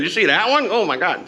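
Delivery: high-pitched imitation of an amazed wife; mock disbelief, pitched well up; shocked wife. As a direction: imitate a scandalized spouse in a high voice. Quoting his shocked wife.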